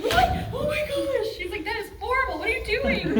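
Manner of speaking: high, loud imitation of an appalled husband; mock horrified reaction. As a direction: exclaim in exaggerated horror, laughter underneath.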